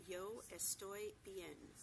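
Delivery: "Yo estoy bien" said very slowly, every sound spelled out; slow model phrase. Shown as a demonstration. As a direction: read it out slowly, letter by letter. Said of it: "pronounced exactly as it's spelled".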